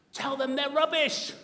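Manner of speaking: high, loud outburst; sudden shouted retort; defiant shout. Acted. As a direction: fire back at the critics at full voice. Defiant retort to imagined critics.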